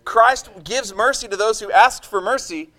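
high, loud, fiery sermon climax; loud, high, impassioned pulpit voice; full-force preaching. Acted. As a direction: preach it out with rising passion.

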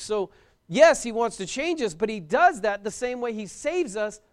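usual speaking voice

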